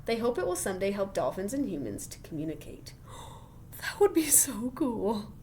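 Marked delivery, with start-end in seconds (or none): 3.0-3.8 sharp soft gasp; excited gasp. Real, not acted. Teacher gasping at dolphin computer news, excited gasp. 3.8-5.3 awed whisper. Real, not acted. Teacher whispering excitement about talking to dolphins.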